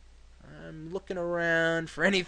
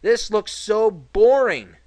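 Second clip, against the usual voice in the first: slow and much louder, dragged out; bored complaint; 6 semitones higher, 11 dB louder, much slower than usual. Real, not acted. Gamer griping at a dull level, slow bored groan. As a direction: drawl the boredom out loud.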